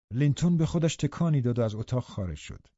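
usual speaking voice

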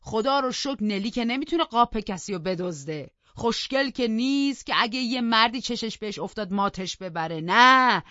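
put-on old Joseph loudly complains to the master; grumbling old servant. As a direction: whine and rant like an aggrieved old servant. Narrator voices old Joseph again.

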